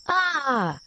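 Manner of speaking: high, falling "ah" of delight; small pig's pleased exclamation; contented cry. A narrator's reading. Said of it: "cries the small pig".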